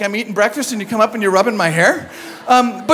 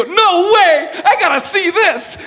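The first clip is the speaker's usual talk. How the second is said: high, laughing eagerness; giddy can't-wait; curious nurse behind the curtain, giggling; eager nurse; 8 semitones higher, slower than usual. Retold or imitated. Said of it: "I heard somebody go".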